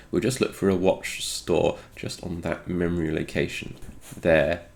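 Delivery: usual speaking voice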